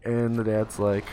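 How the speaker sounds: usual speaking voice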